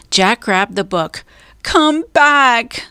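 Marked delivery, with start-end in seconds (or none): 1.6-2.8 high urgent shout. A narrator's reading. Annie shouts after Jack running away, high alarmed call. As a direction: shout to bring him back. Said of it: "Annie shouted".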